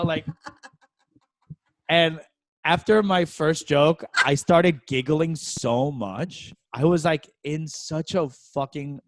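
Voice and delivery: usual speaking voice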